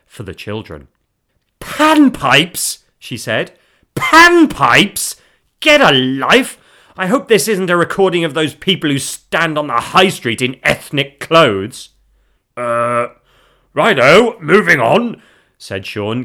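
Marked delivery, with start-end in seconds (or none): 1.6-2.8 loud, high, incredulous outburst; appalled exclamation. Acted. Wife peering at the unwrapped CD, appalled exclamation. 4.0-11.9 loud, high, incredulous scorn; scornful outburst. Acted. Wife rejecting her husband's CD, indignant outburst. 12.6-15.2 loud, forced breezy brush-off; feigned unconcern. Acted. Husband covering after his gift is scorned, feigned unconcern. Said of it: "unconvincing illusion of not caring".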